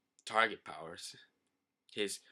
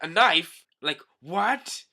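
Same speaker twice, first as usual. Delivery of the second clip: shocked; 5 semitones higher, 8 dB louder, much slower than usual. Acted. Hachi stabbed by knife.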